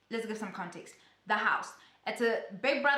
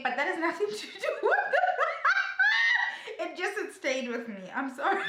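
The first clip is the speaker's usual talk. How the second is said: real high-pitched cackling, words breaking into laughter; helpless laughing; 13 semitones higher than usual. Real, not acted. Vlogger cracking up at her own remark, laughing. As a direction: crack up mid-sentence and apologise through it.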